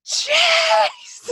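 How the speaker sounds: high, loud mock holler; drunken yell. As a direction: playact a drunk calling across the bar. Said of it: "I want Jay to get drunk".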